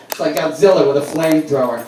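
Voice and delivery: ordinary talk, nothing marked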